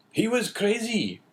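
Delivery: high, slow, emphatic; emphatic cry. A narrator's reading. Inspector recalling the abbé, emphatic and high. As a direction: insist he was mad, pitched well up. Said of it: "cried Monsieur de Beauville".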